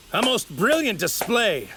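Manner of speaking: high, theatrical villain praise; grand admiring exclamation. Acted. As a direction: applaud the rival with a flourish. Villain applauds his opponent's power.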